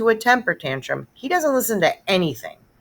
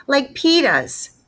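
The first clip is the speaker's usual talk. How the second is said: put-on slow, higher little-kid voice; toddler voice; 9 semitones higher, 4 dB louder, much slower than usual. Fudge telling his mother which shoes he wants, slow toddler voice. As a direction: drag it out like a small child.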